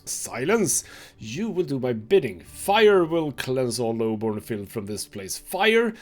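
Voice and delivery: forceful, doom-laden zealot's rant; fanatical raving grandfather. Acted. Grandfather threatening fire to cleanse the lowborn, fanatical rant. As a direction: rave like a man set on cleansing by fire.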